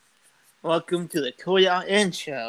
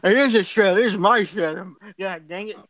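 mock angry protest; 4 dB louder than usual. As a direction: defend your trailer, hammed-up outrage. Acted quarrel, defending his trailer.

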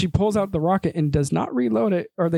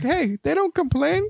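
mocking high voice; 11 semitones higher than usual. Mocking gas attendants.

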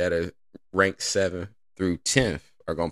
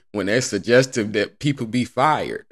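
ordinary talk, nothing marked

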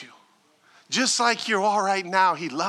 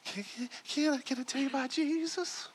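much softer, stammering, nervous asking; timid squeaky voice; 6 semitones higher, 11 dB quieter than usual. Acted. Shy believer asking a friend, timid and soft. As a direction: barely get the words out, shy. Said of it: "your voice is squeaking".

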